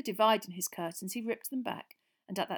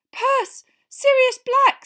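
ordinary delivery, nothing marked

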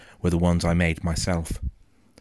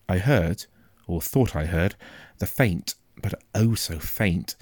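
ordinary delivery

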